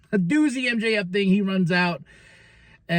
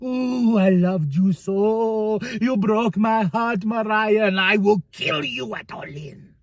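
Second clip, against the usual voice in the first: slow, hammed-up heartbroken soap-opera threat; melodramatic; much slower than usual. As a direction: ham it up like a betrayed lover. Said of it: "she's like".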